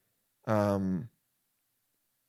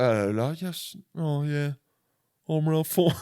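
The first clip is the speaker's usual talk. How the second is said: hammed-up suave British actor; badly faked British accent; mock British accent; 4 semitones higher, slower than usual. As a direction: put on a silly British voice. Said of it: "he's, like, British".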